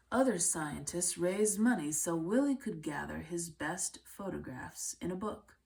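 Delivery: usual speaking voice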